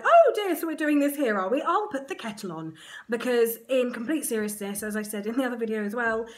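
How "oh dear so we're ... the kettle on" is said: high, bright mock-casual mum, louder; mum's cheery voice. Mum arriving to daughter in labour, unbothered and chirpy. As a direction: play the unflustered mum putting the kettle on. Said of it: "She came in, was like".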